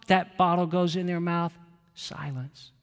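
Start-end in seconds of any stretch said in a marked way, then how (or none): 1.9-2.7 hushed. Shown as a demonstration. Describing the hush after feeding.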